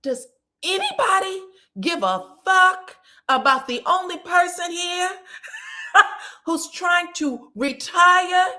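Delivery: high, loud mock outrage breaking into a laugh; outraged mother. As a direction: squeal it like a mother fed up with everyone. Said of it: "And she says".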